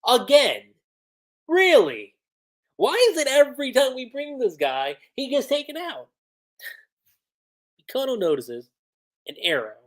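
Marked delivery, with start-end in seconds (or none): 0.1-0.7 louder exasperated repeat; louder disbelief. Retold or imitated. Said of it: "She's like". 1.5-6.1 higher, whiny mock outrage; exasperated complaint. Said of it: "She's like".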